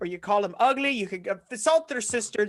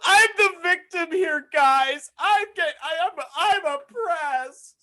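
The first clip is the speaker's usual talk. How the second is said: high, melodramatic fake wail; mock victim; 8 semitones higher, 3 dB louder, slower than usual. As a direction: plead your case like a hammed-up martyr. Mock complaint to the audience.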